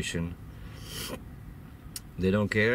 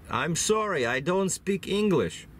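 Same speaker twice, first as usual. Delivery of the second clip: higher, slower mock-innocent imitation; movie character; 10 semitones higher, slower than usual. McConaughey's character fooling the rebels, mock-innocent and high. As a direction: play dumb to the rebels, drawn out and pitched up. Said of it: "Matthew McConaughey's character says".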